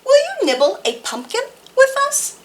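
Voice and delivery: high, quick little mouse. A narrator's reading. Mouse asking the squirrel to nibble, high and quick. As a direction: chatter it like a mouse. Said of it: "said Mouse".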